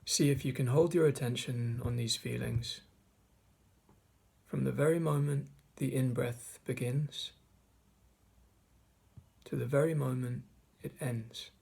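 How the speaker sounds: measured meditation guide, long pauses between phrases; guided meditation. Acted. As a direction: guide listeners through the breath, leaving space after each phrase. Leads a mindfulness meditation.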